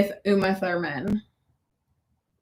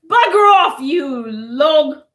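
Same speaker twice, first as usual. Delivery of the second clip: louder, higher British insult; shouted British accent; loud mock British; 4 semitones higher, 6 dB louder, slower than usual. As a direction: tell him off like an angry Cockney.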